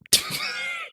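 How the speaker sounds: a sudden high-pitched laugh; high squeal of laughter. Real, not acted. Cracking up at her own Harambe joke, a squealing laugh.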